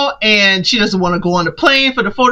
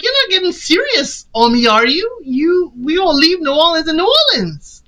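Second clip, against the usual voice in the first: lightly voiced Jacqueline, pitched high; imitating a flirty woman brushing off commitment; high teasing voice; 6 semitones higher than usual. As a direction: tease him in a higher voice. Jacqueline teasing him, voiced quote.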